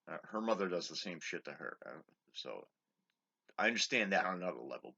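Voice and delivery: normal talking voice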